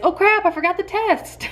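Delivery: high, startled oh crap; sudden realization. Retold or imitated. Retelling to viewers how she forgot the test, startled. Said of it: "I realized".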